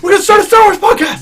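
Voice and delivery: high, loud excited outburst; excited shout. Real, not acted. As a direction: shout it with glee. Excited shout about new podcast.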